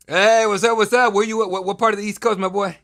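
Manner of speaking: loud, high, pumped-up hello; hyped greeting. Real, not acted. Greeting a caller on show.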